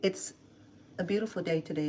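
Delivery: ordinary talk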